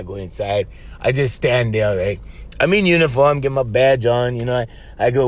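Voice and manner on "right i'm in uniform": usual speaking voice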